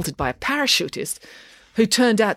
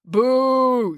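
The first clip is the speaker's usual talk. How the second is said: long, high booing call; drawn-out high boo; crowd heckle; 8 semitones higher, 3 dB louder than usual. A narrator's reading. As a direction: jeer from the back of a crowd. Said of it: "someone called from the crowd".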